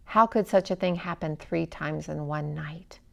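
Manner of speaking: ordinary delivery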